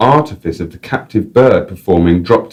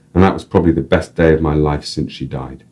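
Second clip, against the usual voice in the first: usual speaking voice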